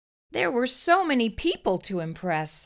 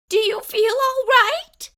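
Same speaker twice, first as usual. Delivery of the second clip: high, louder anxious mother voice; worried mother; 7 dB louder than usual. Acted. Missus Cream checking Camilla's forehead, worried high voice. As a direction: fuss over her like a worried mom.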